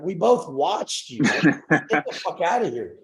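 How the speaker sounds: slow, drawn-out telling-off in a changed voice; telling parents off. Retold or imitated. To his parents at the door, slow fed-up telling-off. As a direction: spell it out slowly, fed up and disgusted.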